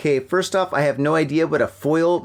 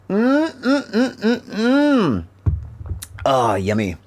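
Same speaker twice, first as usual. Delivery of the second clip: savouring sips; 6 semitones higher, slower than usual. Real, not acted. Sips drink after toast.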